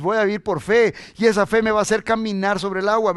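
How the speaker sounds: loud, driving preacher declaration; full-force preaching. Real, not acted. Preacher defines walking on water by faith, full-force preaching.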